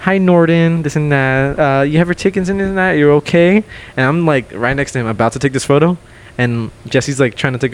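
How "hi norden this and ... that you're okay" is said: higher-pitched mock ladies' greeting; imitating two ladies. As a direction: put on a high voice for the ladies. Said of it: "here come these two ladies again".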